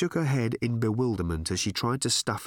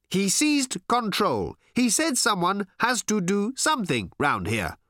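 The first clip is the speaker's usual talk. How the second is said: high, emphatic alien voice; an alien reporting solemnly on the leader; stilted, high-pitched alien delivery; earnest alien visitor; 10 semitones higher, 4 dB louder, slower than usual. Acted.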